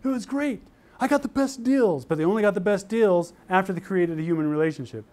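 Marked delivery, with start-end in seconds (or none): 0.0-2.0 high, gushing student voice; excited students. Retold or imitated. Students reporting back on bargaining, high gushing excitement. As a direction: bubble over like a thrilled student. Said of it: "They come back".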